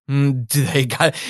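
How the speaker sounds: normal talking voice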